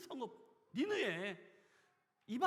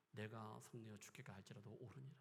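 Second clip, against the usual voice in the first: softer, muttered 옳으니라 with a rise; sullen quiet reply; 7 semitones lower, 11 dB quieter than usual. Acted. Jonah sulking at God, quiet. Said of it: "막장으로 가고 있는 그 모습".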